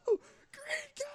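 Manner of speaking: very high, soft strained falsetto wail; tiny far-away scream of grief; mock anguish. Streamer mourns the fallen green guy, mock anguished falsetto. Acting player's dismay at character's death.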